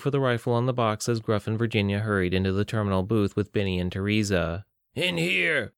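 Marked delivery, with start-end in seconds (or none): 4.9-5.7 high call to take cover; yell. Acted. Benny calls the others into the booth, high yell. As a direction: shout them into the booth. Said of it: "Benny yelled".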